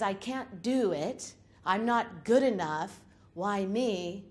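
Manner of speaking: slow, self-doubting whine; a hint of a defeated inner voice. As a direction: sound small and unsure of yourself. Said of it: "when your lower self says".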